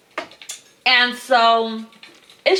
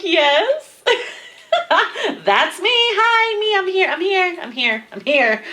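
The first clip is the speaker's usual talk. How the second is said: high, loud burst of giddy excitement; excited recognition; 10 semitones higher, 3 dB louder than usual. Retold or imitated. Viewer answering the video that describes her, giddy and excited. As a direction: call out eagerly to be counted, half laughing.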